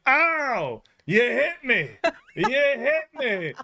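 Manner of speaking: high, whiny cry of a fake-hurt man; faking injury. Scam man who fell on the car, whining high at the drivers. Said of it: "was like".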